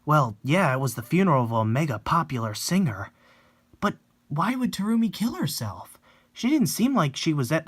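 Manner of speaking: normal talking voice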